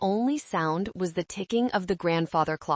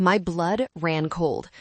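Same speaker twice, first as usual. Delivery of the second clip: usual speaking voice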